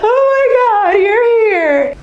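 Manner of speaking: imitating a loud, high wailing cry; retold bawling. New mother greeting her friend at hospital, mock bawling. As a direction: wail it high and loud, mimicking tearful relief.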